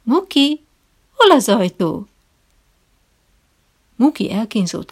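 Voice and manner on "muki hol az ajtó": high, sugary coaxing question to the dog; honey-sweet. A narrator's reading. Trainer sweetly quizzing his dog for the visitor. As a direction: sweeten it like talking to a pet. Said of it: "És most megint mézédes hangon".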